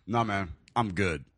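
low, slow, soft brush-off; disgusted refusal. Retold or imitated. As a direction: imitate a friend's disgusted, muted refusal. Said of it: "look of just disgust and goes".

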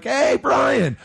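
high-pitched, hammed-up club drunk; loud and boisterous. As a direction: imitate a boisterous drunk hailing a hero. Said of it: "I'm very loud and boisterous".

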